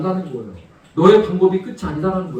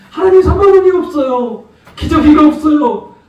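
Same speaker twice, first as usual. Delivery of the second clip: high, louder whimpering plea for money; mock the believer's self-pitying prayer; a childish wail to God, pitched up; whiny complaining prayer; 7 semitones higher, 7 dB louder than usual. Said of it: "너는 왜 거기서 울고만 있냐".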